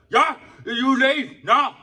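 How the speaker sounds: very slow, high, loud mock dismissal; high drawn-out imitation ordering him out; mocking the worker. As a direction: stretch every word like the rude worker, hammed-up. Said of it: "He's like".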